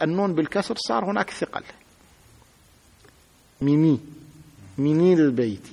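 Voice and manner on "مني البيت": مني البيت said with a kasra on the nun, showing the clumsy sound; heavy pronunciation. Shown as a demonstration. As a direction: demonstrate the awkward mini-lbayt. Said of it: "في ثقل أو لا في النطق".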